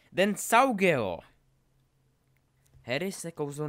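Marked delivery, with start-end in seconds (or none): 0.1-1.2 high, loud, stretched incantation; shouted spell. Acted. Draco casts at Harry mid-duel, shouted spell. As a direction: cast it with a full shout.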